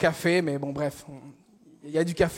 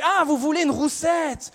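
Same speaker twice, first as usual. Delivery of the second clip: higher-pitched, drawn-out dawning surprise; sudden realization; 6 semitones higher, slower than usual. Acted. Cashier finally understands customer's order, relieved surprise. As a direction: let the penny drop on a high note.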